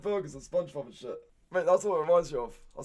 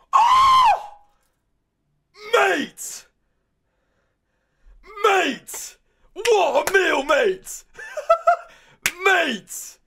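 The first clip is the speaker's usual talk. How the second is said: much higher, louder thrilled yells; real delighted outburst with laughter; excited shouting; 9 semitones higher, 7 dB louder, much slower than usual. Real, not acted. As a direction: cheer as if the meal blew you away. Thrilled reaction to great meal.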